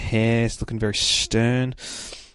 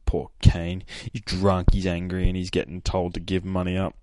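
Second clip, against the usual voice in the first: ordinary talk, nothing marked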